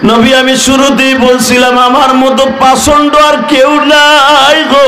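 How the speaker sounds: anguished sing-song cry; remorseful lament. Acted. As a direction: wail the confession melodically. Killer's self-blame, chanted high.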